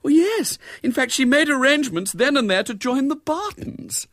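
very high, loud wifely fluster; high-pitched upset; distressed confirmation. A narrator's reading. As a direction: report the snub in dismay. Upset wife confirms the snub.

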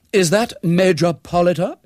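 put-on high, loud, haughty young lady; extremely haughty. A narrator's reading. Haughty young woman telephoning a Major, extremely haughty. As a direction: demand it with great hauteur. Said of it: "attractive but extremely haughty voice".

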